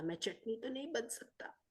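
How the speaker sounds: unvoiced whisper to himself; whispered thought. Harry whispers a private resolve to himself, whispered. Boy's inner thought about fear.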